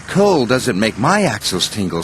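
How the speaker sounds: ordinary delivery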